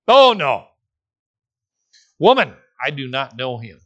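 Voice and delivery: loud, firm no, then steady; confident denial. Retold or imitated. Peter to the servant girl, a mildly acted confident denial. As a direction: flatly deny knowing him, lightly acted. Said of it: "as confidently as he answered".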